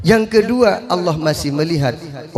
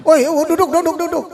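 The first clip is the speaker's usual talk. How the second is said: shout; 13 semitones higher than usual. Retold or imitated. Teacher shouting at fighting kids to sit down.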